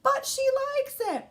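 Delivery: put-on high, loud kid protest; high-pitched little-boy insistence; David's excuse. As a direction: quote it in a child's defensive cry. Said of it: "why would he say".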